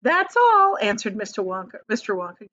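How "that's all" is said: much higher, louder proud declaration. A narrator's reading. Wonka showing off his invention, proudly. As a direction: announce the result with showman's pride. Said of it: "gazing proudly at the result".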